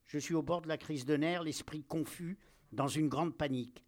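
ordinary delivery

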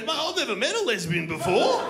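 high, thrilled mock bogan voice; excited bogan. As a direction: blurt it with delighted amazement. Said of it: "he was excited".

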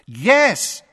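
sharp, loud, high angry yes. A narrator's reading. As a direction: snap it back angrily. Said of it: "said Harry angrily".

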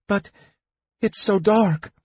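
high, timid woman's voice; frightened protest. A narrator's reading. Woman afraid of the dark stairs, frightened protest. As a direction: protest in a small, scared high voice. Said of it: "her voice shaking".

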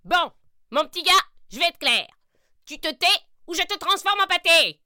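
voix de sorcière aiguë et forte; sorcière menaçante. Acted. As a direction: menace le petit lapin. Sorcière menace le lapin. (sorcière qui menace)

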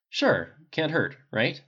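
slow, doubtful shrug of a reply; skeptical. A narrator's reading. Bruce to the captain, unsure about trying meditation, slow and skeptical. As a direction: draw it out, not convinced. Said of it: "He looked skeptical".

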